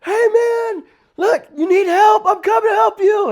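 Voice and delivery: loud high shout across the yard; retold loud offer of help; calling out. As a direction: call out to the officer mid-fight. Calling to officer in fight.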